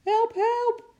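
put-on very high shriek of a little girl; panicked child's screech; terrified cry. A narrator's reading. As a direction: scream for help while running. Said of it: "meisje met een feestmuts op".

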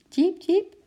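hoog piepend diertje, een beetje angstig; angstig gepiep. A narrator's reading. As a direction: piep hoog als een bang beestje. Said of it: "piepte Eki heel zacht en angstig". (hoog, angstig diergepiep, niet echt zacht)

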